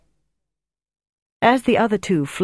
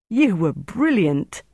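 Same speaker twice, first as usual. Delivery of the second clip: higher, drawn-out admiring praise; enthusiastic; 4 semitones higher, slower than usual. A narrator's reading. Rescued agent praises his rescuer, enthusiastically. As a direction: gush with slow emphasis. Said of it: "Helicopter said enthusiastically".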